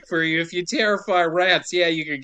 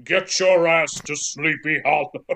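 Christopher Lee. Shown as a demonstration. Podcaster on request as the judge, stately impression. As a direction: intone it like a grand old wizard. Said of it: "do it like Christopher Lee".

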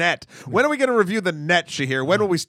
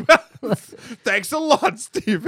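laughing; 8 semitones higher, much slower than usual. Retold or imitated. Retelling own laughing reaction.

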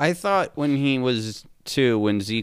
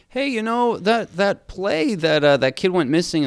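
high mock teacher voice; 9 semitones higher, 3 dB louder than usual. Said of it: "you're like".

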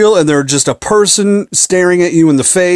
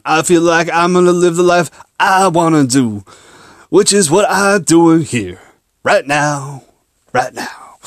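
Mitch Hedberg impression; 3 semitones higher than usual. Shown as a demonstration. Podcaster slipping into a comic's voice, Hedberg impression. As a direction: stretch the words in a mock comedian cadence. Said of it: "like, Mitch Hedberg voice every now and again".

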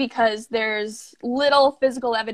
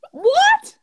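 surprised; 7 dB louder than usual. Real, not acted. Shocked by attorney's reward offer.

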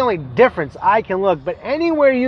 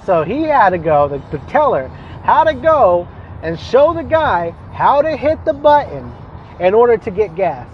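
ordinary talk, nothing marked

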